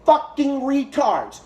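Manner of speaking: high, emphatic angry rant; real exasperated anger. Real, not acted. Berating hosts over the vaccine skepticism claim, exasperated anger.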